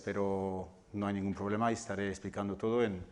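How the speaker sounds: ordinary delivery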